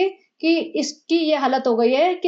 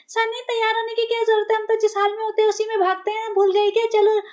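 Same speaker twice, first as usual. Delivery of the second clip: much higher, eager put-on squeal of a pampered wife; mock giddy wife; 9 semitones higher than usual. Mocking the wife eagerly telling Sunny she needs no getting ready. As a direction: gush it out, ready to run.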